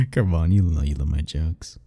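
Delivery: usual speaking voice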